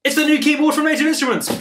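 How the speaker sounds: high, excited announcement; super hyped. Real, not acted. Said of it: "which I'm super hyped about".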